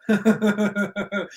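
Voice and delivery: stifled chuckle breaking into the speech; real laugh. Real, not acted. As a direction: crack up mid-sentence. Breaks up laughing mid-statement.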